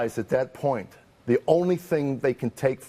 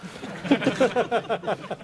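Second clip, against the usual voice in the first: a burst of high laughter; 12 semitones higher than usual. Real, not acted. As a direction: laugh it out, pitched up. Laughing while retelling rage.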